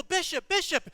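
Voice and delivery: loud, high call to a passing car. Retold or imitated. Stranded preacher hails the passing bishop, calling out. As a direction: shout his name to flag him down.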